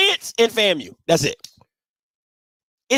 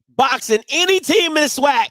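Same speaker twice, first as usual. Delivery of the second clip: ordinary talk, nothing marked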